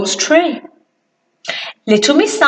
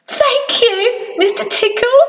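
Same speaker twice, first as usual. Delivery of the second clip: relieved thanks; 9 semitones higher than usual. Acted. Rescued Mister Small thanks Mister Tickle, high relieved. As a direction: breathe out relief. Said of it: "a very relieved Mister Small".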